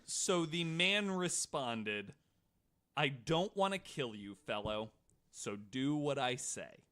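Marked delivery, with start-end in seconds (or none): none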